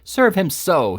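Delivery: hammed-up loud voice. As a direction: shout it across the room. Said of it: "reiterated Slurk in a loud voice".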